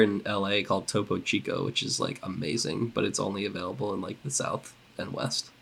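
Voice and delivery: whispered aside, like a secret. Real, not acted. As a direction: drop to a whisper. Secret aside about Topo Chico.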